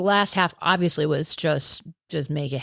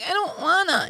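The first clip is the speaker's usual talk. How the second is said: high, whining refusal; whiny toddler; 11 semitones higher than usual. Retold or imitated. Runner retells refusing her pacer, high toddler whine. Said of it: "toddler status".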